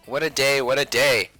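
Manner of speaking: ordinary delivery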